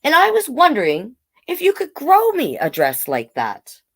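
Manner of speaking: put-on child telling mother about a dream. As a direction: sound like a hopeful young child. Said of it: "Bailey said".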